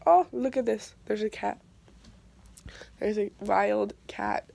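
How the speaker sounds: ordinary delivery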